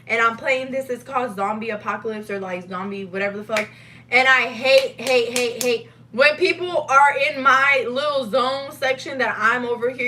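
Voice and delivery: ordinary talk, nothing marked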